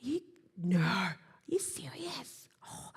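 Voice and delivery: hushed, slow disbelief with little voice; shocked sympathetic friend. Acted. Coach acting a friend just standing there, hushed disbelief. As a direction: whisper it slowly, aghast on their behalf. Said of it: "Just stand there and go".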